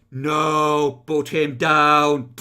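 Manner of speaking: slow, loud robot voice. Shown as a demonstration. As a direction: say it like a stiff robot voice. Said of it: "say in that robotic voice".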